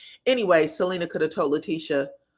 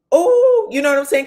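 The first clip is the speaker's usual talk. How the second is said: mock scandalized; 7 semitones higher, 9 dB louder than usual. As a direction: imitate onlookers catching someone out. Imitating onlookers' reaction to the admission.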